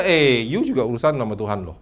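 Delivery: ordinary talk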